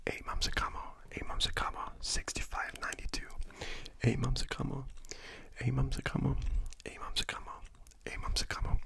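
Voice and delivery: soft unvoiced whisper, close to the mic; ASMR whisper. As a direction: whisper good night gently, like ASMR.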